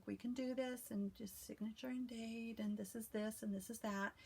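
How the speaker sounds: soft, soothing notary voice; a quiet, reassuring murmur to calm a client at the signing table. Shown as a demonstration. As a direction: settle an overwhelmed signer gently. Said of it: "I do the calm voice".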